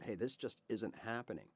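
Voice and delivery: ordinary talk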